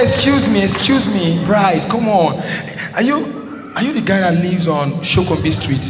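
put-on high-pitched voice of a girl cutting in; girl suddenly recognising someone; interrupting girl. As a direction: break in with a high, questioning lilt. Girl interrupts his crammed speech.

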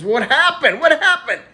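alarmed doctor. Acted. Doctor alarmed at injured Johnny.

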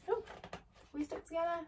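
asking in surprise; surprised question. Real, not acted. Reader breaks off, surprised, asking a question. Surprised aside mid-reading.

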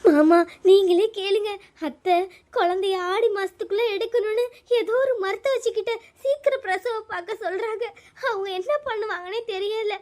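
acted crying appeal, sobs between phrases; tearful complaint. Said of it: "அழுதுட்டு இருக்க". (அழுதுகொண்டே முறையிடும் குரல்)

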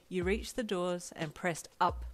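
ordinary delivery, nothing marked

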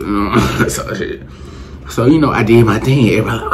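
usual speaking voice

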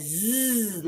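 long buzzing "zzz"; letter sound demo. Shown as a demonstration. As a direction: hold "zzz" as a sustained voiced buzz. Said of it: "Z says".

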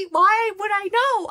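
high, loud, drawn-out protest; exasperated. Retold or imitated. Fan asked when she returns, exasperated. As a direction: wail it high and slow, at a loss. Flustered reply about coming back.

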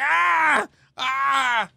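high shouted mock screams. Rabbit's head flung back on the ride, shouted yelps. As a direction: yelp it as the head snaps back. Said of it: "así como que".

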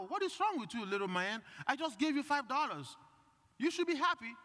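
high, incredulous dad voice; dad throwing up his hands in disbelief; exasperated dad. Retold or imitated. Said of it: "And his dad".

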